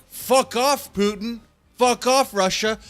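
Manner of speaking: loud, high, defiant shout; defiant yell. Retold or imitated. Ukrainians shouting down Putin and Russia, defiant yell. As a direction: yell it like you're telling the invaders to get out.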